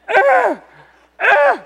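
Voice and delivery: high, loud groans of pain. Retold or imitated. Pastor mimicking his aching shoulder, pained groans. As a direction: grunt as if the shoulder aches.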